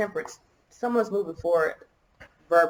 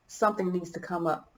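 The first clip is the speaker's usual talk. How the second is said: ordinary talk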